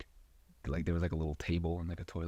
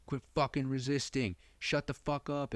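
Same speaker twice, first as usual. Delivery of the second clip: retold angry cop orders, higher; cops yelling; 7 semitones higher than usual. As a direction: bark it at the guy on the ground. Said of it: "they were just like".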